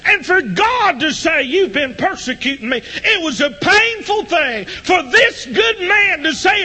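loud, high hollering revival preaching; shouted sermon climbing to a holler; preaching at full force. Acted. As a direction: preach it out with fire to the whole room.

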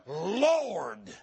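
"Lord," long, deep, reverent fall. Shown as a demonstration. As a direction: lean into "Lord," low and slow like true worship. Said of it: "It's how you say that word".

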